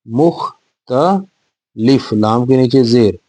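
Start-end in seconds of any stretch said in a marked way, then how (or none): 0.1-2.1 मुख्तलिफ drawn out slowly, syllable by syllable; spelled out slowly. Shown as a demonstration. Teacher to children sounding out the word, slowly. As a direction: stretch मुख्तलिफ out for the pupils to follow. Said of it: "ये ते के ऊपर आएगी जबर".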